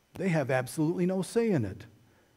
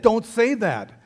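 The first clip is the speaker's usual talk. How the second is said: first yell, louder but held back; retold yell; 5 semitones higher, 5 dB louder than usual. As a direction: snap it at dad, not full force yet. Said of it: "he yelled at me".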